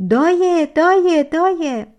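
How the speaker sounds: high, loud shout that fades with each repeat. Acted. Stepsister at the pool screaming for the giant. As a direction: yell the name out. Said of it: "شروع به فریاد کرد".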